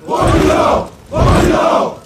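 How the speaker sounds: loud chanted name; crowd acclamation. Acted. Rebels acclaim a newly named brother, roaring shout. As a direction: roar the name back.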